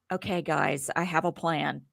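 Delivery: a hint of a low, quiet plotting voice; lightly voiced Tucker. Imitating Tucker pitching his plan to the house, low and quiet. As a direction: mumble it like a schemer with a plan. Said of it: "and Tucker's like".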